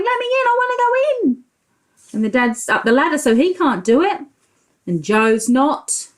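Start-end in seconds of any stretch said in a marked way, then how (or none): 0.0-1.4 high, fast pleading dog voice; dog's voice. Acted. The dog at the door begs Joe, high fast whine. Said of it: "The dog's saying".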